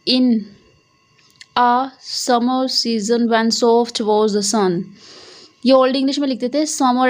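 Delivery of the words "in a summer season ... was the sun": slow reading aloud. Shown as a demonstration. Teacher reading an example line slowly to students. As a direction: read the poem line very slowly, word by word. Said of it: "देखो".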